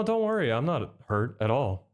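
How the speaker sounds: ordinary talk, nothing marked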